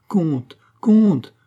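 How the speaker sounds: high falling cry, building in urgency; alarmed appeal. A narrator's reading. Alarmed queen calls to count.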